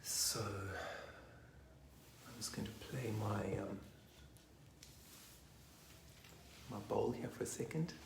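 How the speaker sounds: quick hushed whisper as she reaches for her bowl. Real, not acted. Whispering to the circle that she'll play her bowl. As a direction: breathe it out, barely voiced.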